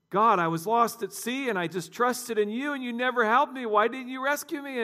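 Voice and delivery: high, rising aggrieved plea; drowned man whining at God; indignant complaint. Acted. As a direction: protest to God, climbing in pitch.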